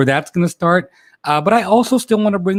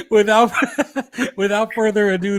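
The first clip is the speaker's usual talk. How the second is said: laughing; 6 semitones higher than usual. Real, not acted. Restarting the intro, giggling.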